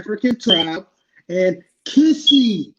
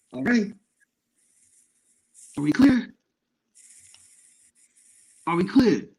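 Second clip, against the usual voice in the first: ordinary delivery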